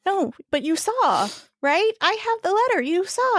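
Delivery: high, pleading insistence; pleading disbelief. Retold or imitated. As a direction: push back, voice climbing in panic. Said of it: "And I was like".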